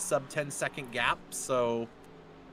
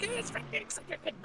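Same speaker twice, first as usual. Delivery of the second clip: pitched way up, playful; high squeaky retort; 7 dB quieter, faster than usual. Retold or imitated. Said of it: "I'm like".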